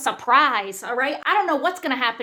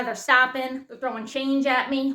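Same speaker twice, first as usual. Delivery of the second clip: ordinary talk, nothing marked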